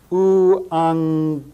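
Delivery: Euangelion as oo-ahng-GEL-ee-on, very slow; slow Greek pronunciation. Shown as a demonstration. Teacher showing students the gamma-gamma NG sound, very slow. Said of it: "N G sound".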